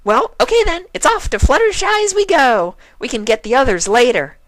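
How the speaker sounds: very high, loud gleeful cheer; hyperactive shout. A narrator's reading. Pinkie leading the way, very high cheerful shout. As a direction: shout it out bouncing off. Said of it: "the hyperactive pony shouted".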